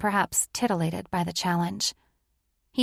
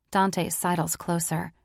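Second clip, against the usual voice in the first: ordinary delivery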